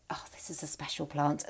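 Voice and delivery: soft reverent whisper; hushed whisper. Real, not acted. Showing her favourite plant to viewers in a hushed whisper. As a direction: whisper it like a precious secret.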